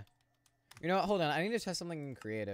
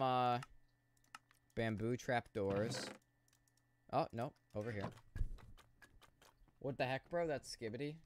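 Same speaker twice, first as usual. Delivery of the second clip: normal talking voice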